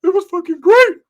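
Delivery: loud high-pitched bragging yell; high shouted boast; high gleeful shout. Retold or imitated. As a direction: belt it out, thrilled with himself. Ricky brags to mom.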